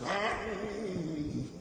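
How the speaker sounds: drawn-out testifying moan; old deacon testifying. Retold or imitated. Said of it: "old deacon testifying in the church".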